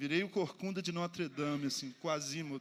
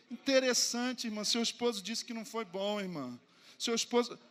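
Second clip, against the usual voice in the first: louder, needling emphasis on "esposo"; pointed sarcasm; 5 semitones higher, 7 dB louder than usual. Retold or imitated. Pastor exposing the husband to the sister, loud and pointed. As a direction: rub her husband's words in her face. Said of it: "o velho homem ressuscitou".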